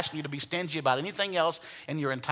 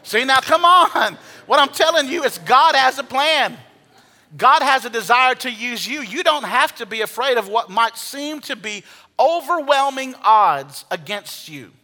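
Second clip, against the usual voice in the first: fired-up preaching; 6 semitones higher, 5 dB louder than usual. Real, not acted. Pastor rallies congregation.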